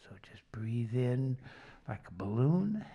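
calm group breathing guide; guided relaxation. Acted. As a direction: talk them gently through each breath.

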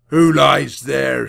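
slow, loud gruff rider voice; menacing thief. A narrator's reading. Thief rider demanding who lies in cart, slow menacing. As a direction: loom over the boy with it.

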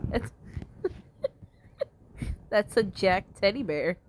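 words squeezed through laughter; laughing. Real, not acted. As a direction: laugh as you say it. Laughing at the monster.

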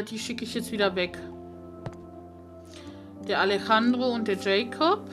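usual speaking voice

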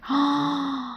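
high, soft intake of breath; delighted gasp. Real, not acted. Player admiring a gorgeous view, soft delighted gasp. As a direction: gasp softly in wonder.

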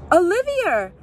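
high, loud call to a runaway cat; urgent calling out. A narrator's reading. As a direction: call the escaping cat back. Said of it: "Mister Sims called out".

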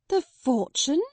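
high, loud startled question; the news hits her; shocked cry. A narrator's reading. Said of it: "cried Miss Minchin".

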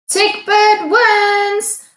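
slow, high, sung-out proclamation; grand announcement. A narrator's reading. Lion announcing the winner to the cheering crowd, slow and grand. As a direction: call it to the whole crowd. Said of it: "announced Lion".

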